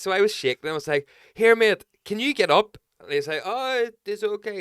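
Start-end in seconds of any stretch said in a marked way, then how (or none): 1.3-2.8 high, loud call to a sleeping stranger; retold high, loud wake-up shout; retold raised call. 3.4-4.6 mock French accent. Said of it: "He was French".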